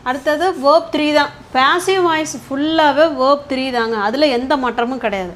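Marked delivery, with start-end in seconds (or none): none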